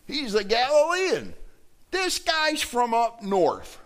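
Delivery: high-pitched accusation, slowing at the end; accusing. Acted. Acting out accusers identifying Peter.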